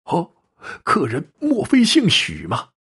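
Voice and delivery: astonished, as if meeting a legend; astonished innkeeper. Acted. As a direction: innkeeper gasping at the stranger's name. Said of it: "主人惊异地说".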